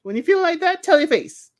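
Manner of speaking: high, insistent retelling of his line; lightly hammed-up advice; mock-emphatic quote. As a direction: quote him with a big, pointed push. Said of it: "He's like".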